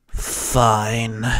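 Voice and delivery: low, resigned sigh of a word. A narrator's reading. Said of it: "Hex sighed".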